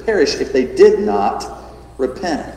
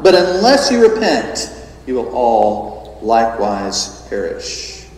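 "perish" falling low and slow; slow solemn warning; 4 semitones lower, slower than usual. Retold or imitated. Preacher repeating Jesus' warning, low and slow at the end. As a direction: bring the weight down on "all". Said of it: "We'd probably say".

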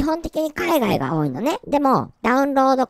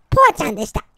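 cute persona sign-off; 5 dB louder, much faster than usual. Shown as a demonstration. Correcting himself to sign off as the cute persona. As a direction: chirp the persona name. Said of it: "あ、違うな。".

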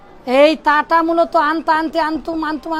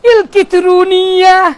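put-on loud, slow Arabic-accented word; 3 semitones higher, 7 dB louder, slower than usual. Teacher showing how Arabs say electronics, Arabic accent. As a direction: stretch it out loudly like an Arabic speaker. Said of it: "আরবিরা কি বলে?".

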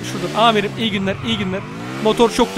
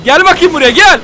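taunting; 2 semitones higher, 7 dB louder than usual. Real, not acted. Taunting an enemy in game.

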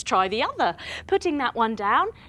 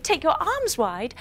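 ordinary delivery

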